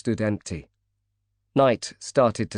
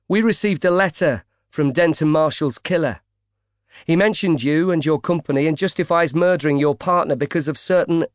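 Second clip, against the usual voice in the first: pushy; 6 semitones higher, 3 dB louder than usual. A narrator's reading. Reporter pushing hard.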